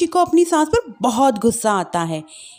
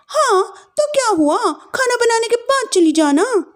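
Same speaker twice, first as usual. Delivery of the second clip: higher, commanding elder bhabhi; bossy sister-in-law; 10 semitones higher than usual. Acted. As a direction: order her around.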